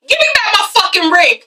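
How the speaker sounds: laughing yell for the ring; hammed-up furious demand; mock angry shout. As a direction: belt it half laughing.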